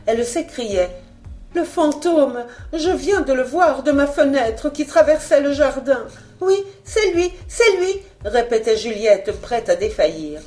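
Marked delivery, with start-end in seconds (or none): 1.6-8.0 high, halting voice full of terror; jerky terrified voice. Acted. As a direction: gasp it out in panic. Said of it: "d'une voix saccadée, avec un accent d'indicible épouvante".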